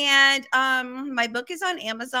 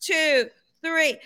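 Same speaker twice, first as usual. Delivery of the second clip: ordinary talk, nothing marked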